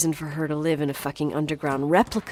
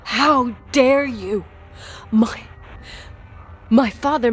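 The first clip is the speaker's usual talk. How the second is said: furious; 6 semitones higher, 4 dB louder than usual. Acted. Daughter enraged at father accusation.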